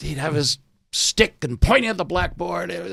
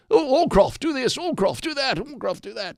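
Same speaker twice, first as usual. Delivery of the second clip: put-on much higher, fussy master ordering a boy about; bossy schoolmaster; 11 semitones higher than usual. Schoolmaster ordering the boy Allcroft around, high mock voice. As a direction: fuss over the boy like a prim old master. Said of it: "So all of a sudden I was".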